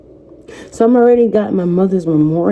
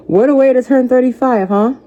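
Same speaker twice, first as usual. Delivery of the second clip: laughing; 7 semitones higher than usual. Real, not acted. Telling viewers about her thirty-fifth birthday, laughing. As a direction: still laughing, pitched higher.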